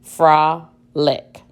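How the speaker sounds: "Frolic" as fra, short pause, lick; syllable by syllable. Shown as a demonstration. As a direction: separate the two syllables of "Frolic". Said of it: "Let's identify the syllables in the word frolic".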